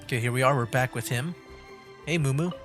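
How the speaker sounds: normal talking voice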